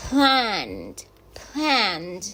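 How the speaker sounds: «Planned» said «pland» with a short A; model English word. Shown as a demonstration. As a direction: say «Planned» twice, clearly. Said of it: "que es planear".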